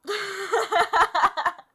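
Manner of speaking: long, high, loud cackle; helpless burst of laughter; loud laughing. Real, not acted. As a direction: crack up at your own mistake. Laughs at own mistake.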